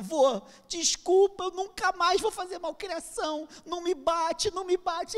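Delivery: high, loud tearful begging, slow at first; crying plea. Retold or imitated. Grandson crying to his grandfather, begging not to be hit. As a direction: beg through tears not to be hit. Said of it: "o garoto veio chorando".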